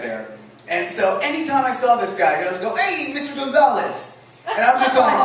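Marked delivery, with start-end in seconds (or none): 2.8-4.2 higher-pitched, cheery hail; mock hearty greeting. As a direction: imitate a coworker calling out hello. Acting out coworker's cheery greeting.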